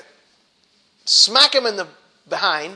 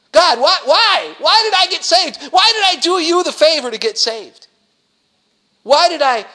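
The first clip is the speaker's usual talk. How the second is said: mock wailing lament; 11 semitones higher, 9 dB louder than usual. Preacher voicing the saved believer wailing at God, hammed-up loud lament. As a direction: cry out to God like a spoiled believer.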